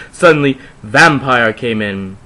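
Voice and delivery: usual speaking voice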